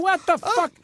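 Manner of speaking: high-pitched, exasperated disbelief; comic incredulity. Acted. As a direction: rant in mock bafflement, pitch pushed up. Mocking rappers' names in disbelief.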